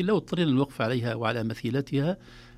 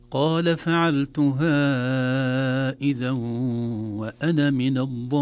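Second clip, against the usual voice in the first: slow, louder chanted recitation; Quranic recitation; 7 dB louder, much slower than usual. Shown as a demonstration. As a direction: chant the verse slowly and fully. Recites the verse. (ترتيل بطيء)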